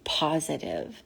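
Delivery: soft unvoiced ghostly whisper; breathy whisper. Imitating the spirit voice caught on the recorder, breathy whisper. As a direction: breathe the word out like a ghost on tape. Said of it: "like, breathy, whispery voice".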